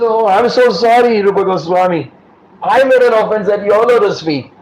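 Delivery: retold heartfelt sorry; earnest apology. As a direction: apologize fervently, voice lifted. Acts devotee apologizing to Rupa.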